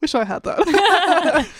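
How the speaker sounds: slow, high words through laughter; real laughing. Real, not acted. Former student envying songs on the new course, laughing. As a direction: say it wistfully while laughing.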